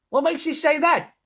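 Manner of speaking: high, loud incredulous question; ground control challenging. A narrator's reading. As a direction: demand an explanation.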